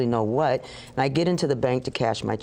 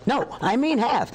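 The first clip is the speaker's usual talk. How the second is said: insistent inner voice; 11 semitones higher than usual. Retold or imitated.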